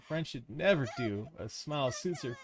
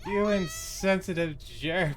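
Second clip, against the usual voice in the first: higher, louder, slow furious insult; angry outburst; 8 semitones higher, 6 dB louder, slower than usual. Acted. Heroine lashing out at her friend, loud and slow. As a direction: spit it out slowly in anger.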